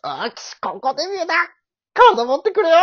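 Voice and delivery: high, loud straining cry; hammed-up anime hero. Redoing the bit for his partner, straining anime hero. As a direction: shout it like a hero at his limit. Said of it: "もう一回やろうか".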